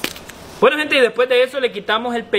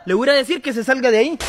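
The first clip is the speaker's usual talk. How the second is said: normal talking voice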